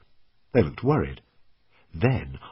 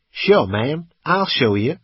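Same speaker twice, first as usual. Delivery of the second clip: higher, louder voice of a cocky driver; young American driver; 7 semitones higher, 7 dB louder, slower than usual. A narrator's reading. Driver offers a lost stranger help, put-on American voice. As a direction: play the young driver, pitched up and loud.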